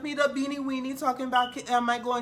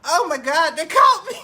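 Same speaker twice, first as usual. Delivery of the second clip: laughing; 3 semitones higher, 12 dB louder than usual. Real, not acted. Caught off guard, laughing hard.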